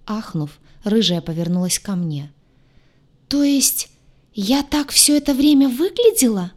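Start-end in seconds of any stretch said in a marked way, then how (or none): 3.3-6.5 high, horrified wail; pitched-up voice full of dismay; horrified moan. A narrator's reading. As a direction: moan it, aghast. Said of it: "в ужасе простонала лагартианка".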